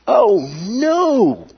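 high, sudden cry of alarm; panicked cry. Retold or imitated. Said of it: "questions of near panic".

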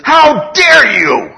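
high, drawn-out shout of indignation; shouted, scandalised challenge; emphatic outrage. Acted. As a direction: thunder it out slowly like a stern rebuke. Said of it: "He is really emphatic about this".

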